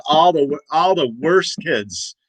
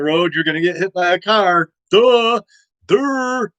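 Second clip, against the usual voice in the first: mocking duh; 5 semitones higher than usual. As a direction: taunt like a schoolyard bully. Mocking dumb-voice taunt.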